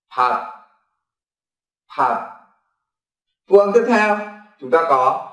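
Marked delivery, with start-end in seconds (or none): 0.1-2.3 "hạt" said softly and cut short; quiet clipped "hạt"; Korean word demo. Shown as a demonstration. Teacher modelling the Korean word for rice, soft and short. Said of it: "chúng ta đọc là hạt".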